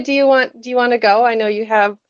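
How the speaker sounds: normal talking voice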